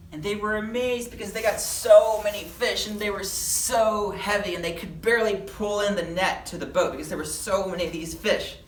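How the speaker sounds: excited storyteller, starting high and loud, easing off; big wide-eyed emphasis on the amount; amazed narration. A narrator's reading. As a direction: marvel at a huge catch for children. Amazed at the huge catch.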